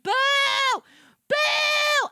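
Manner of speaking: high, loud boos; loud theater booing. Retold or imitated. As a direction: boo at the screen. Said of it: "I said".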